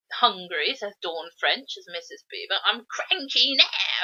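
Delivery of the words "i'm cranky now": slow, drawn-out mock Cockney accent; Ray Winstone. Mocking Ray Winstone's Beaver line in the film, Cockney impression. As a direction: lightly imitate a Cockney actor, higher and stretched. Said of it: "says Ray Winstone".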